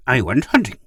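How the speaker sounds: high, loud and pleased with himself; complacent. A narrator's reading. Gur tells Pid of hunting, complacent. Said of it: "Gur said complacently".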